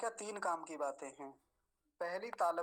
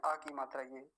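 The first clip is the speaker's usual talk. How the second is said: ordinary talk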